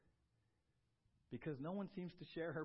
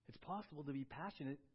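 ordinary talk

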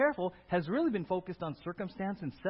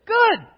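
good hit much higher and louder; punched word; 13 semitones higher, 15 dB louder than usual. Real, not acted. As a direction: land the word good hard. Preaching climax on key word.

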